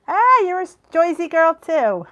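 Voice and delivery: loud, high, broad Jersey accent. Shown as a demonstration. Greeting a fellow Jersey girl, loud Jersey accent. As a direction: call it out in thick Joisey. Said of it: "I normally don't have a New Jersey accent".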